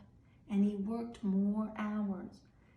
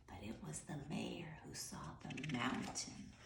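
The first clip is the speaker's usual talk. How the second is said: usual speaking voice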